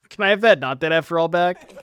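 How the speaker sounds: loud, blunt demand. Real, not acted. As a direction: bark it out as a demand. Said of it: "I'm asking nicely".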